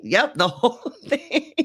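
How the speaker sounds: laughing through the words; amused, egging her on; laughing reply. Retold or imitated. [name] answers, laughing.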